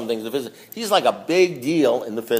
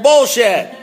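higher, louder one-word scoff; blunt vulgar retort; 8 semitones higher, 6 dB louder than usual. Retold or imitated. Voicing the book's blunt meaning to the meeting, a loud scoff. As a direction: spit it out as a crude dismissal. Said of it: "if they wanna be a little bit vulgar".

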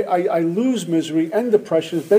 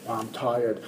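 low, soft, worn-out mumble; weary sigh; 6 semitones lower, 9 dB quieter than usual. Retold or imitated. Alcoholic answering how he's doing, tired and low. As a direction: sag into it like you're exhausted. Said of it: "we go".

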